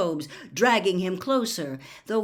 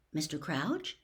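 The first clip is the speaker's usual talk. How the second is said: softer, hesitant calling of his name; cautious; 3 semitones lower, 7 dB quieter than usual. A narrator's reading. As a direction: approach him gently.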